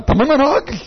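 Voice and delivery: high-pitched mock protest; protesting son. Son insisting to his parent he is a man, protesting. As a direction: answer back like an aggrieved son.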